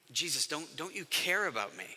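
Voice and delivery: quiet, low plea, hesitant at first; hurt pleading. Acted. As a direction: plead softly, as if let down by someone trusted. Voices fearful follower pleading with Jesus.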